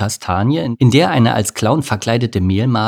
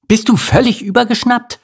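very high, loud outraged snap; hissing fury; 13 semitones higher, 4 dB louder than usual. A narrator's reading. Ant snapping at Bodo, furious. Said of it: "fauchte Amelie Bodo an".

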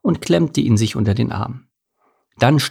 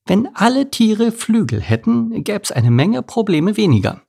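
high, lilting thrush voice; high-voiced storybook thrush; little bird character; 12 semitones higher than usual. A narrator's reading. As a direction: pitch it way up like a small bird.

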